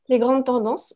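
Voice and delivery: ordinary talk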